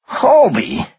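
very high, astonished echo; incredulous. A narrator's reading. Finance guy repeating the culprit's name, incredulous. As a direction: repeat the name in sheer disbelief, voice way up.

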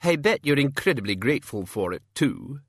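high, sharp servant's voice; pointed, haughty page; sharp-tongued page. A narrator's reading. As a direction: prod him sharply for gratitude. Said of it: "said the page, sharply".

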